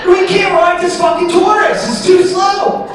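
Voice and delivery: very high, loud mock Aussie complaint; frustrated Australian. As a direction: yell it up high in a fed-up Aussie voice. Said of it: "he's like".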